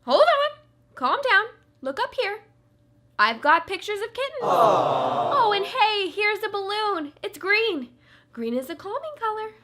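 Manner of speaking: soothing. Acted. Judge soothing upset plaintiff.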